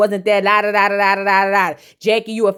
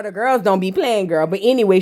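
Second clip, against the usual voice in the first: ordinary talk, nothing marked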